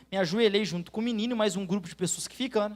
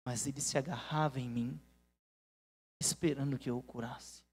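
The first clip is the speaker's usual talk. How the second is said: hushed recollection; 7 semitones lower, 8 dB quieter than usual. Real, not acted. Remembering boy clinging, hushed.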